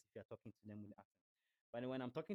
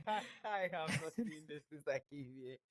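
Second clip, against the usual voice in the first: high, loud, laughing disbelief; loud, tickled astonishment; amazed laughter; 6 semitones higher, 8 dB louder than usual. Real, not acted. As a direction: laugh out loud in real surprise.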